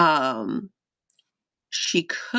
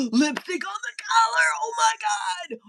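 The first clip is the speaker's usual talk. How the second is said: much higher, almost shrieking, hammed-up horror; mock scandalised shock. Streamer acting out finding lipstick on a collar, shrieked in mock horror. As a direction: play the gossip who just found the proof. Imagines cheating evidence.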